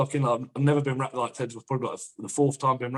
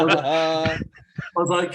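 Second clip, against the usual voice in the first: high and loud, laughing through it; can barely get it out for laughing; laughing retelling his own request; 4 semitones higher, 6 dB louder, much slower than usual. Real, not acted. Laughing at bench memory.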